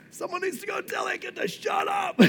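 very high, strained comic shout; hammed-up high-pitched yell; mock exasperated outburst. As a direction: squeal it at the end of your tether. Acted exasperation at screaming kid.

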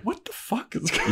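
high, laughing what the fuck; baffled outburst. Retold or imitated. Xander baffled as Anya storms out, high and laughing.

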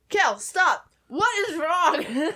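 playful imitation of someone alarmed; imitated exasperation. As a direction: stretch out a dismayed question, half laughing. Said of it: "Chris was like".

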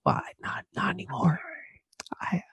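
hesitant, barely voiced admission; pitiful whisper. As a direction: whisper haltingly, as a helpless confession. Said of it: "this is my voice".

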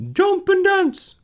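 very high, loud crow; drawn-out piercing rooster call. Acted. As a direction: crow the words slowly like a rooster. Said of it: "said the rooster".

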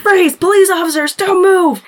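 high, loud cop commands; mock police shout. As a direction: yell it like a TV cop making an arrest. Cops confronting the ghost hunters.

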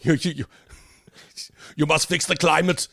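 hammed-up accented command; Greta Thunberg. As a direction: botch a Swedish accent into Arnold. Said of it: "that's basically what it sounded like".

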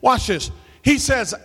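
ordinary delivery

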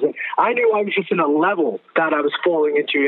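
ordinary talk

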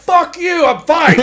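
high, loud angry refusal; angry retort. Retold or imitated. Kid refusing help from ski patrol, angry yell. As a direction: yell it back, too proud for help. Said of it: "I'm so angry".

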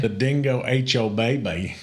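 put-on Australian movie-line delivery; mock Australian accent. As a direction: quote it in hammed-up Australian. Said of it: "it ends up turning into Australian".

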